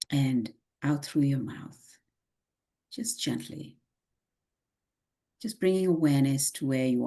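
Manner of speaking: calm, unhurried meditation guide; guided meditation. Acted. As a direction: lead the breathing gently.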